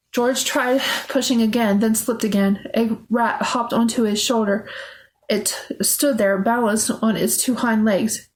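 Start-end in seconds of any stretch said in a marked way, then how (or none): none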